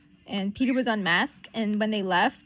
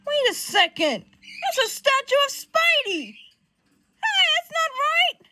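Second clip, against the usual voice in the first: loud, high protest climbing higher; indignant outburst; 8 dB louder than usual. Acted. Johnny recognizing Peter's sculpture, pissed-off high outburst. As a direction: protest loudly, pitch rising with each phrase. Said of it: "This pisses him the F up".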